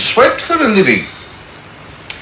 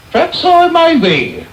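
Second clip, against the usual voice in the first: PERHAPS I MAY BE lifted much higher, preening; pompous self-satisfied repeat; 12 semitones higher than usual. Acted. Beadle basking in the matron's praise, pompous higher repeat. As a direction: savour the flattery, pitch leaping up.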